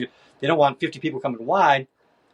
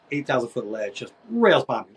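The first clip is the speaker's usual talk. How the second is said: ordinary talk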